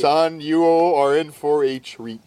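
very slow, drawn-out promise; savouring announcement. Retold or imitated. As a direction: stretch it out, relishing the treat. Father's delighted announcement to his son.

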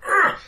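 short, high strained grunt; pained little yelp. Acted. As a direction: let out one involuntary yelp of pain. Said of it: "tiniest little sort of yelp".

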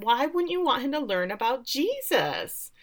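high-pitched mock-innocent question; irritating know-it-all. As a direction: imitate a smugly pious busybody. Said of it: "she's so irritating".

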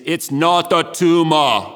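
put-on slow, thick Austrian accent; amateur Austrian-accented movie-quote impression; Arnold Schwarzenegger. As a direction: do an Austrian action star's accent, drawn out. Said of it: "in my interpretation of his voice and, um, accent".

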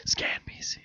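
soft stage whisper. Pretend. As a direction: hush it like a secret. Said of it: "I like saying it like that".

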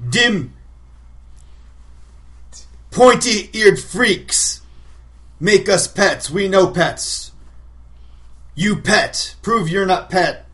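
loud, slow brutish orc warlord; rally the crowd like an orc chief; orc war boss voice. Acted. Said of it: "You trying to sound like an orc".

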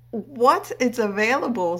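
high, loud disbelieving "What?"; surprised. Retold or imitated. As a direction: gasp it out in happy shock. Retold surprised reaction.

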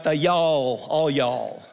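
lightly drawn Deep South "y'all"; a hint of a Deep South drawl on "all y'all"; Deep South accent. Shown as a demonstration. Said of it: "the Deep South version".

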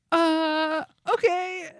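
high, stunned "Uh, okay"; bewildered acceptance; stunned high-pitched "okay"; baffled okay. Retold or imitated. Stunned reaction retold.

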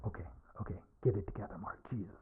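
low self-talk; muttering to himself. Acted. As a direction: quietly psych yourself up.

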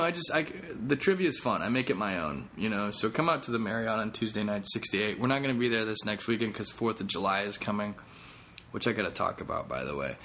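ordinary delivery

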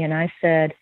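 ordinary talk, nothing marked

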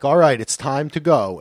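lightly voiced dad calling time; a hint of a tipsy dad; retold dad. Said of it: "he'd be like".

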